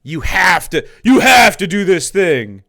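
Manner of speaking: loud, slow mock shout; mocking demand. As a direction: bark it like a bossy scold, hammed up.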